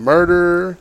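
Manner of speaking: high mock yell of kids. Kids yelling at the trapper, high mock yell. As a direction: yell it like shocked children.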